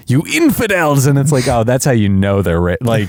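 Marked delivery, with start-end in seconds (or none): 0.1-1.1 mock movie-villain Middle Eastern accent, raised pitch; higher, hammed-up Middle Eastern accent. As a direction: imitate a badly dubbed Middle Eastern villain. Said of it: "at one point they were like".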